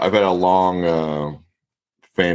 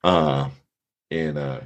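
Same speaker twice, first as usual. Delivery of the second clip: ordinary talk, nothing marked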